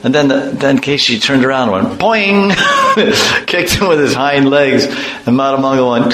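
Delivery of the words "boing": high, springy cartoon boing. The horse demon kicking with hind legs, high cartoon boing. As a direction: make a bouncy cartoon kick sound. Sound effect of kick.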